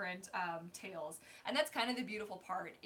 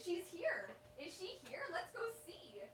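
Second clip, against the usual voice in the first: much higher, sing-song voice for the pet; 7 semitones higher, 7 dB quieter than usual. Real, not acted. Telling the dog their friend is here, high baby talk. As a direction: coo excitedly at the dog.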